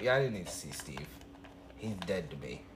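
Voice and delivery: ordinary talk, nothing marked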